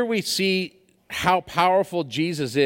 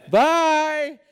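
lightly voiced mocking bye, higher; a hint of a taunting brother waving goodbye; teasing brother; 10 semitones higher, 3 dB louder than usual. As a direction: brother left far behind, calling a cheeky goodbye. Brother teasing, far behind.

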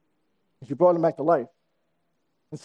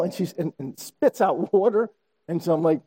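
usual speaking voice

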